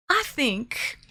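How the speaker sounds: a touch of a southern accent. Real, not acted. Streamer guessing who was first in chat, southern accent. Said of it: "speaking with a southern accent".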